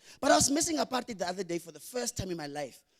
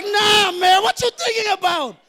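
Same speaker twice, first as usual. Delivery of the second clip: high, loud indignant yell; indignant shout; 9 semitones higher, 8 dB louder, slower than usual. Retold or imitated. Brushing off friends' teasing, high loud indignant yell.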